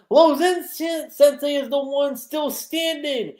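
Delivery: high, loud hyped announcer shout; announcer call. Retold or imitated. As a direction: call the winner to the whole arena. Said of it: "the announcer's like".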